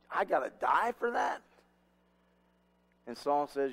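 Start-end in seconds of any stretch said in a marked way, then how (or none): 0.1-1.4 higher-pitched acted disbelief; mock-shocked son's question; incredulous protest. Son incredulous at death sentence.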